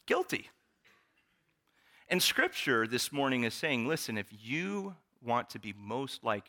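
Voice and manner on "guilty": playful admission. Real, not acted. Admits the kids take after him.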